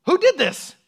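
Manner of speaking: sudden high, loud royal demand; hammed-up outraged king; furious king. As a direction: bellow it like a king who just learned of betrayal. Enraged king in retold story.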